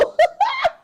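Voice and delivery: sudden shrill burst of laughter; high shrieking laugh. Real, not acted. Laugh burst after joke.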